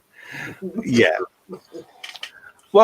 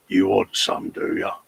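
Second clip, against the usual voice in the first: lower, tough-guy London geezer taunt; 7 semitones lower, 4 dB quieter than usual. Retold or imitated. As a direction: square up like a pub geezer, low. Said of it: "the art of being a geezer".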